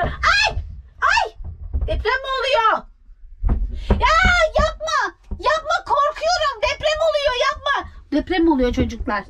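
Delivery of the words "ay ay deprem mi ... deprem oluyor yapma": high, startled cries; sudden fright, pitched way up; alarmed. Real, not acted. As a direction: plead in a high panicky voice. Cupboard shakes, says she is afraid.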